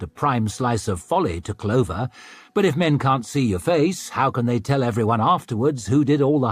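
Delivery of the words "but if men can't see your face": plain reading voice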